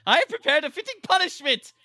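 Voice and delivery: high, loud, theatrical pronouncement; mock villainous threat. Shopkeeper punishing someone who left without buying, mock menace. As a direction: declare doom in a hammed-up character voice. Acting Beedle punishing the non-buyer.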